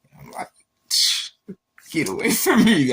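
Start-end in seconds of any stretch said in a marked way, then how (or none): 1.9-2.9 louder and higher, a laugh breaking in; mock angry yell, laughing. Pushing God's gift away, laughing mock yell. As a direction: shout it back half-laughing. Retold angry refusal to God.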